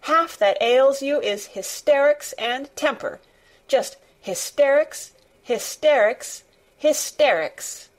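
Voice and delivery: put-on cross girl driving the word home; angry stamping insistence. A narrator's reading. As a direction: punch each HYSTERICS like a stamp of the foot. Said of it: "she stamped each time she said it".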